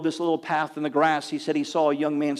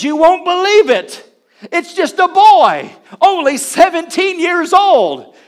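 high, loud, stretched-out exclamation; amazed proclamation; 13 semitones higher, 11 dB louder, slower than usual. Retold or imitated. Villagers telling the evangelist about the boy preacher, amazed. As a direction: shout the astonishing news slowly, word by word.

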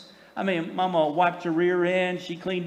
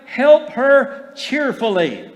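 slow and louder, with HELP leaned on hard; preacher drilling home "help her cheerfully" slowly and louder, leaning on HELP; emphatic slow call; 7 semitones higher, 9 dB louder, much slower than usual. Shown as a demonstration. To the congregation, a slow, louder "help her cheerfully" with HELP punched. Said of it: "I said".

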